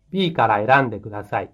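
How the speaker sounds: ordinary delivery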